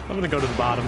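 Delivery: normal talking voice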